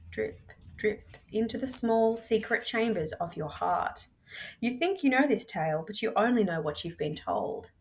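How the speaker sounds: ordinary delivery, nothing marked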